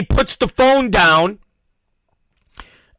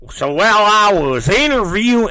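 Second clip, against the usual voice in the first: cop's drawling voice. Retold or imitated. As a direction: put on a drawling cop accent. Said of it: "that's how he sounds".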